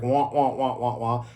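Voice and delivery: "wah-wah" noise for muddled talk; mimicking garbled speech. Teacher showing how an unclear accent can sound to him, mock garble. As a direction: run the wahs together like unintelligible speech. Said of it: "accent and their enunciation mixes to the point".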